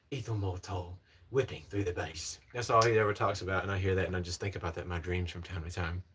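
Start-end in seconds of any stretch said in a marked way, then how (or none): 0.0-2.4 mild British accent impression of a perfume reviewer; Aaron Terrence Hughes. Streamer mimicking a perfumer's little spray routine, British-accented impression. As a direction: imitate a British fragrance expert after a gasping sniff. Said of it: "if you guys watch Aaron Terrence Hughes".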